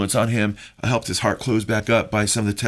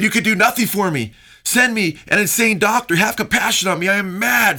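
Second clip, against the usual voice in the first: much higher, louder, panicked deathbed raving; frantic madman; 10 semitones higher, 3 dB louder than usual. Retold or imitated. Preacher voicing dying Voltaire's terror, frantic and high. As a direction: cry out like a man losing his mind. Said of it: "went insane".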